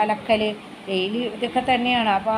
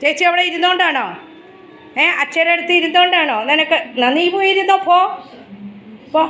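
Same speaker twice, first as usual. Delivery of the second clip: pet-talk voice; 5 semitones higher, 4 dB louder than usual. Real, not acted. Mother sending her pet off to bed, fond scolding. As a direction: shoo the little one off, half scolding, half doting.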